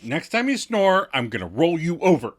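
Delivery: high, loud teasing threat; mock warning. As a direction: warn him, half joking. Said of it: "And Chandler warns".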